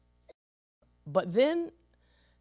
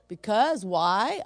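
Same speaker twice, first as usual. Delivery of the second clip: laughing; 4 semitones higher, 6 dB louder, slower than usual. Real, not acted. Laughing into the point.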